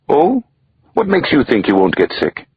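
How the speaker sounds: nanny teasing Mike, arch and surprised; arch surprise. A narrator's reading. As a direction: feign surprise, then challenge him.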